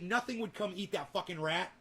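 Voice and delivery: usual speaking voice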